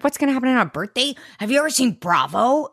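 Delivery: high, fast, cartoonish voice for the Basket; cartoon elephant. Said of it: "she became, like, a cartoon elephant".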